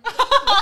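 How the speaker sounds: sudden high-pitched laugh; burst of high laughter. Real, not acted. Hearing the dog-poop answer, a high burst of laughter. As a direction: let out a sharp, high laugh.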